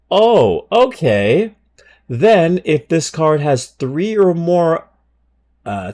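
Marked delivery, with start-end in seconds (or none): 0.1-4.9 high and loud surprised read; startled reading. Real, not acted. Streamer shocked by a card effect mid-read. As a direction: react as the card gets crazier.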